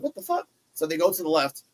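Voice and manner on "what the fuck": high startled mock disbelief; a sudden high what-the reaction; mock shock. Reacting to the brawl in the background, high mock shock. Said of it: "I'm like".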